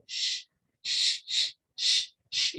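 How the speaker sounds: soft voiceless sh, drawn out; hissed sh sound. Shown as a demonstration. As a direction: demonstrate a low-intensity voiceless consonant. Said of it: "uma consoante que ela tem baixa intensidade". (som de sh surdo e suave)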